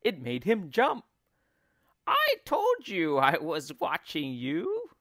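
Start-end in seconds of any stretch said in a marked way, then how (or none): 2.0-4.9 higher, louder cackling wife; cackling crone. A narrator's reading. Wife gloats that she was watching him, cackling. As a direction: crow it triumphantly like an old crone. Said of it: "crackled Missus Twit".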